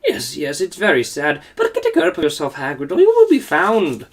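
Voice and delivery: ordinary delivery, nothing marked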